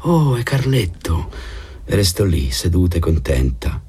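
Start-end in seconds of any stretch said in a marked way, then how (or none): 0.0-1.3 lightly raised, slow glad recognition; a pleased little lift as she spots him; a hint of a young woman, slowed and higher; Linda's voice. Linda spotting an old friend.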